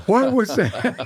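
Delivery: high, slow, laughing disbelief; mock-shocked radio manager at dinner; startled listeners. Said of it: "right at dinner they're going".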